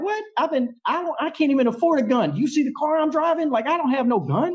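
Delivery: squeaky retold disbelief; incredulous protest. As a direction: protest you can't afford a gun. Retold panicked protest to girlfriend.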